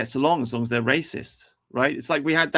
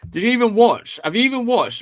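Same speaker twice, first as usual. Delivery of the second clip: higher, louder, incredulous jab; annoyed outburst; 10 semitones higher, 6 dB louder than usual. Real, not acted. Streamer snaps at a chat commenter who missed the stream, loud incredulous outburst. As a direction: fire it back at the heckler, raised.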